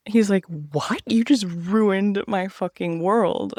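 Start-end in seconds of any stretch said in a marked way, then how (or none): 0.5-1.0 shocked whisper. Retold or imitated. 1.1-3.5 mock devastated. Friend devastated by news.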